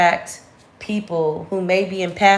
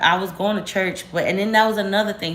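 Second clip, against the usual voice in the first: ordinary talk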